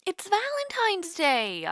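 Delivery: high, slow, drawn-out cry of excitement; excited exclamation. Acted. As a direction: stretch out Valentine's with a big lift in pitch. Reminding him of the date.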